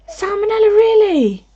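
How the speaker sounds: disbelieving repeat of the viewer's news; high disbelief. Real, not acted. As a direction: ask it like you can't believe it.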